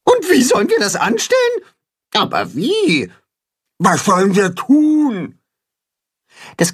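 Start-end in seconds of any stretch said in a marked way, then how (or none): 0.0-5.4 high, anxious questions; the crowd calling out in worry; high alarmed animal chorus; worried animal voices. Acted. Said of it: "Alle rufen durcheinander".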